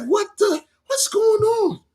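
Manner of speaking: high, startled disbelief; shocked. Retold or imitated. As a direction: react like you can't believe your eyes. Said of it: "I'm like".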